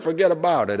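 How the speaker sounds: "Forget about it" said as a single run-together Brooklyn word; one quick Brooklyn word, "Forget about it"; Brooklyn fuhgeddaboudit. Shown as a demonstration. Showing the congregation the Brooklyn one-word version. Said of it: "It's just one word".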